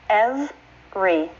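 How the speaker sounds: slow "Every", EV, pause, ree; two syllables. Shown as a demonstration. Teacher sounding it out, "Every" slowly in two syllables. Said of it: "The pronunciation is two syllables".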